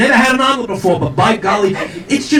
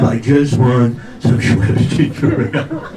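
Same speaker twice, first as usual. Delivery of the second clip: put-on slow, low elderly mumble; low, plaintive old man's grumble; muttering old husband; 7 semitones lower, slower than usual. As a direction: mutter it slowly, like a henpecked old man. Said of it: "the husband's muttering himself".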